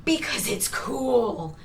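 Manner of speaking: slow, whispery awe; drawn-out, hushed "cool" in parody; mock teenage reasoning. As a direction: play a teenager impressed by their own idea. Mocks own teenage writing logic.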